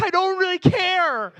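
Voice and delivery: slow, high, loud inner protest; exasperated shout. Acted. Walker silently fed up with a chatty stranger, exasperated shout. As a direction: yell the thought out, drawing it out.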